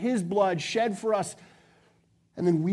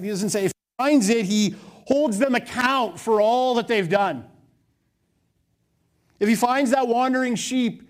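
louder preaching at full force; passionate yell; 3 semitones higher, 6 dB louder than usual. Real, not acted. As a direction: preach it hard, with fire. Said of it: "I'm yelling at you right now".

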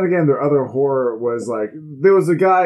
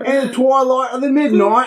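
mock British accent; 12 semitones higher, 4 dB louder than usual. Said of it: "read in a British accent".

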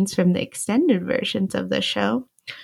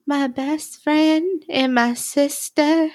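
higher, slow, drawn-out imitation; softened repeat; 6 semitones higher, slower than usual. Host retelling Ross accepting they're in love, softened imitation. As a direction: imitate him saying it slowly, in a changed tone. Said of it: "Ross then adjusts his perspective and repeats".

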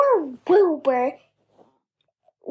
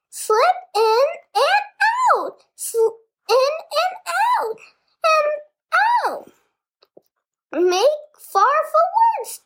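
high goose giving orders; bossy goose; 9 semitones higher than usual. A narrator's reading. Goose bossing Wilbur, high-pitched and honking. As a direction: honk out commands. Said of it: "began to give orders".

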